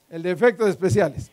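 ordinary talk

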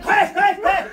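loud, high imitation of crashing brush; explosive sound-effect words; shouted crashing. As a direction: shout each crash like something bolting away.

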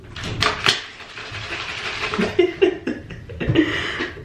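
long, drawn-out laugh. Real, not acted. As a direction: laugh at length, trailing on. Laughs after saying drink water.

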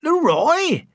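high incredulous echo of the name; can't believe the big name; astonished. A narrator's reading. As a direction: Freddie stunned by the name. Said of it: "Delighted at Freddie's surprise".

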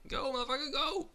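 high, loud frantic yelling; excited shouting. Real, not acted. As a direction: urge the team on in a rush.

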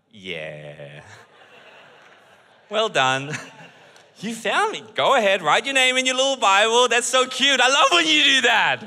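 put-on God humouring his children; God amused. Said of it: "And I'm sure God's like".

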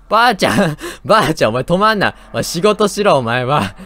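loud, high teasing scold; mock scolding. Real, not acted. Telling the lunch-lady granny to go to work, loudly scolding. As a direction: berate her half-joking.

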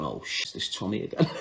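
low, hushed inner thought; quiet, sinking mock dismay; retold dread. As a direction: drop right down, as if thinking it privately.

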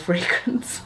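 normal talking voice

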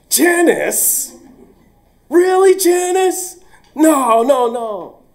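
very high, loud, slow shocked cry; shocked disbelief. Retold or imitated. Teacher learning his star student is the thief, loud high shock. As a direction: cry out in stunned denial.

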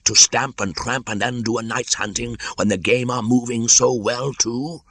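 high, loud, indignant scolding; irate python, pitch held high throughout; angry. A narrator's reading. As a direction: scold the clumsy intruder, drawing out the last words. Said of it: "said Kaa angrily".

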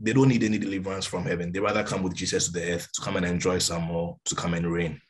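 normal talking voice

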